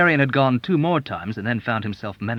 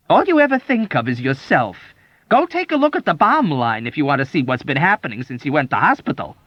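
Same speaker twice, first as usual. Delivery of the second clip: scolding; 6 semitones higher, 5 dB louder than usual. A narrator's reading. Sulking doctor scolding.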